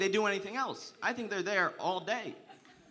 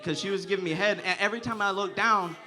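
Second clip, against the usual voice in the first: usual speaking voice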